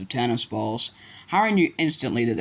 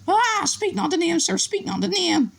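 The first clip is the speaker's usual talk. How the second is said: much higher moaning elf. A narrator's reading. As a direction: moan in fear.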